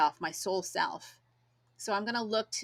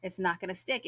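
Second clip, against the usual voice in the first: normal talking voice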